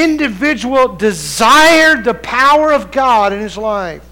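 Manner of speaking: high, forceful pulpit delivery; preaching. Real, not acted. Preacher urging congregation about God's power, preaching hard.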